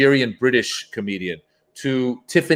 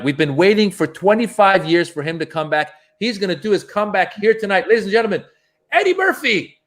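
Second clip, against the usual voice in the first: imitated emcee build-up, climbing high on the name; retold showbiz introduction; stage introduction; 6 semitones higher than usual. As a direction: hype up the big guest, pitch rising to a peak. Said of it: "Paulie goes".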